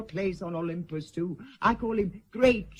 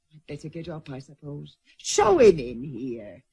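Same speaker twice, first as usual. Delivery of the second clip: ordinary delivery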